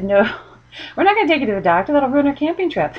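fast, laughing through the words; giggling mock refusal, quick and bright; laughing tease. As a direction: tease him while cracking up. Mom teasing son, laughing.

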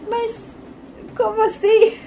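surprised. Retold or imitated. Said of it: "a gente fica assim".

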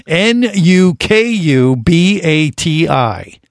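slow letter by letter spelling; spelled out slowly. Shown as a demonstration. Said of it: "I'm gonna just spell it for everybody".

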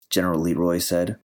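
ordinary delivery, nothing marked

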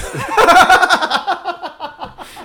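howling high laugh; can't stop laughing; loud high laugh. Real, not acted. Laughing at Brett's text.

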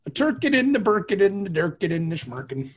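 high mock Swedish chef rhyming, fading. Friends riffing on turducken, high mock Swedish chef. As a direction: chant the nonsense rhyme like the chef.

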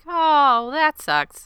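high, loud fake sympathy; exaggerated "too bad" reaction; mock dismissive sympathy. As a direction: put on a shallow, sing-out pity. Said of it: "just gonna be like".

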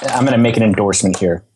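ordinary talk, nothing marked

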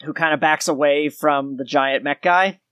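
usual speaking voice

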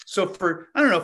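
ordinary talk

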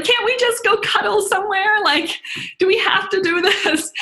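put-on high, childlike pleading voice; scared heart's voice. Speaker's frightened heart begging to go cuddle, high pleading. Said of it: "My heart was like".

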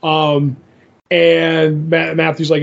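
ordinary delivery

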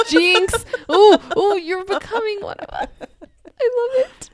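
high gleeful laughing tease; excited. Real, not acted. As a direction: squeal with delight.

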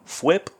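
quick rising fwip sound; arrow whoosh. Shown as a demonstration. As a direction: toss off a light fwip. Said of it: "here we have a little sound effect".

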